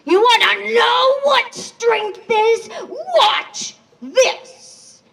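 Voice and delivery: high, loud enraged yell; furious shout. Acted. Angry man shouting at the animals before shooting. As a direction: roar the threat out in rage.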